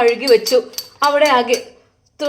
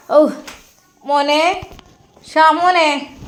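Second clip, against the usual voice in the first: louder call across the house, drawn out; calling out to son; 3 semitones higher, 4 dB louder, much slower than usual. Acted. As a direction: call him from a distance, mother to son. Mother calling her son.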